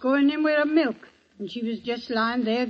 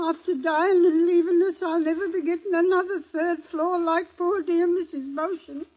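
high, crying lament; wailing lament; 6 semitones higher, 3 dB louder than usual. Real, not acted. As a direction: break into a weepy wail. Charwoman wails over the dying lady.

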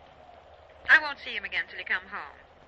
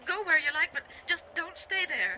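high, urgent pleading; implore, with the voice lifted; desperate plea; 6 semitones higher than usual. Acted. Wife begging her husband to get out, desperate. Begs husband to leave house.

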